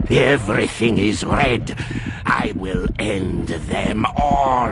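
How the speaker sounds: berserker rage boiling over; mounting fury. Acted.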